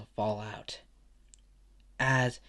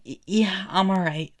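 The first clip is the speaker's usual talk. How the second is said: higher, louder, stammered reassurance; eager stammer, pitched up; nervous fan; 8 semitones higher, 5 dB louder than usual. Acted. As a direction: answer your idol with a starstruck stutter. Nervous fan answers his idol.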